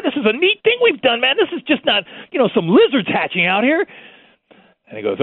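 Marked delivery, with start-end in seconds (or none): 0.0-3.9 high, eager excited explaining; high-pitched excited self-defense to the boss; excited pleading. Retold or imitated. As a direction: win the boss over with your enthusiasm. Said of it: "I go".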